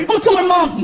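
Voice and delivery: lightly voiced whiny kid; kid threatening. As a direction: threaten like a little boy. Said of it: "he's like".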